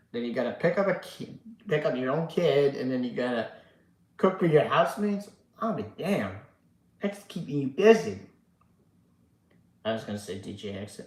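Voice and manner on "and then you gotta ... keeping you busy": ordinary talk, nothing marked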